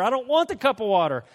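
high, loud mock tantrum; hammed-up whining refusal; bratty protest. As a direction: refuse it like a petulant child. Acted tantrum refusing water.

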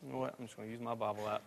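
half-mumbled to himself, fast; quiet aside. Real, not acted. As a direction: drop away from the mic while searching. Muttered aside while finding chapter.

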